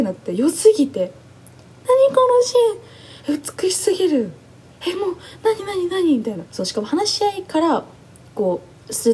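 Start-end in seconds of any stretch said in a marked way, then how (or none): 1.9-6.2 high, very slow, near-tearful wonder; moved almost to tears; choked-up amazed outburst; overwhelmed awe. Retold or imitated. Stunned by a beautiful scene.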